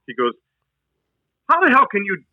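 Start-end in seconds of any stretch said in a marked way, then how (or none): 1.5-2.2 sergeant scolding. Retold or imitated.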